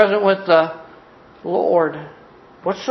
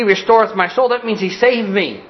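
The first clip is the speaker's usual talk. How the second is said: high, driving, insistent declaration; triumphant preaching; 6 semitones higher than usual. Real, not acted. Preacher testifying he is on his way to heaven, triumphant driving delivery. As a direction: declare it with mounting conviction, phrase after phrase.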